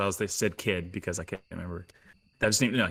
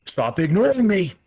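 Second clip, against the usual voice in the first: high, loud, furious shout; frustrated yell; 12 semitones higher, 9 dB louder than usual. Acted. Axe yells at the hobgoblin for ignoring him, frustrated shout. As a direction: demand to be heard at last. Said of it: "get even more frustrated and say".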